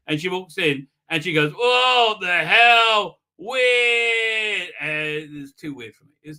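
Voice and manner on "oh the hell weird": loud, high exaggerated disbelief; mock shock. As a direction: act out a hammed-up startled outburst. Acted shocked reaction in recap.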